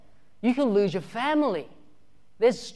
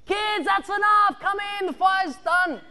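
high, slow shout from a window; parents calling out; 11 semitones higher, 4 dB louder than usual. Retold or imitated. Parents calling the kids in from the window, high, slow shout. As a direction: call the kids in from afar. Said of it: "the parents from the windows go".

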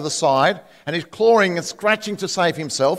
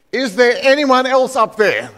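higher, louder desperate yell; 6 semitones higher, 5 dB louder than usual. Acted. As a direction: shout up to the sky for someone else. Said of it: "Then a tourist yelled".

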